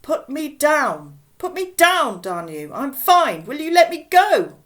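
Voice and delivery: high, loud outraged demands; indignant shouting. Acted. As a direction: shout over a hubbub to be put down. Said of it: "a voice rising above the general hubbub".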